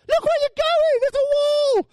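very high, loud mock scream; hammed-up terrified yell; panicked warning. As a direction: shriek a warning at a careless driver. Passengers warning the careless skipper.

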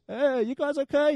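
high, casual imitated skipper; boatman checking in. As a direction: mimic a carefree skipper calling to passengers. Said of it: "this Greek Cypriot guy sailing the boat a bit like this".

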